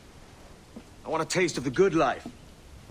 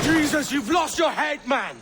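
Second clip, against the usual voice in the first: alarmed; 10 semitones higher than usual. Acted. Kenway alarmed at Vane.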